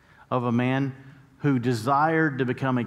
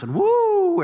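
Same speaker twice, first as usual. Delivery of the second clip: a sudden high, loud woo; high excited woo; 8 dB louder than usual. Pretend. Preacher mocking an overnight change with a high, loud woo. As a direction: whoop it like a miracle just hit.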